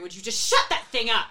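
put-on crackly, leering old hag snapping; haggard frazzled crone. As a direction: screech like a frazzled old witch. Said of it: "very haggard, frazzled crackling, leering".

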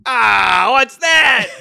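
loud, high cry of disbelief; shocked yell. Real, not acted. Player seeing the new Pokemon revealed, shocked yelling. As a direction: shout it out in startled horror, half laughing.

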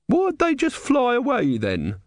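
high, slow challenge, dropping at the end; demanding. A narrator's reading. Woman challenging the man with the tray, demanding. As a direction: demand an answer, pitched far up. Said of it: "the woman demanded".

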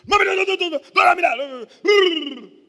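put-on wailing child shouting no; crying child. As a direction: cry and scream like a child begging mom.

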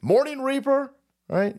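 usual speaking voice

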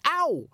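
high sharp yelp of pain. Acted. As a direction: cry out from a clip round the ear. Boy hit on ear again.

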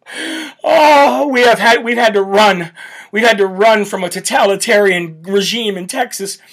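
put-on a whimpering, wailing cry-baby lawmaker; mocking the Texas Democrats as sobbing victims; mock whiny Democrat. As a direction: ham it up like a self-pitying runaway. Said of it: "take my ball and run away from my job".

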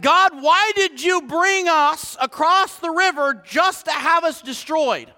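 mock-indignant question thrown up at God. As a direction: retell it as an aggrieved, slow demand. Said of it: "Joshua is questioning God".

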